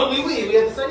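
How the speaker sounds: mock French. Mocking fancy French sake talk.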